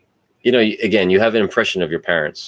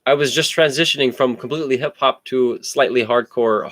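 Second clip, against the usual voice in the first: ordinary delivery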